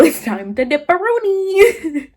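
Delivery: high, singsong let's-get-out-of-here; lightly comic urge to bail; jokey exit line. Retold or imitated. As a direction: pitch it up playfully. Jokey urge to flee the party.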